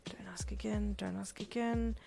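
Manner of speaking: whispered through gritted teeth. A narrator's reading. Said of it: "gritting your teeth".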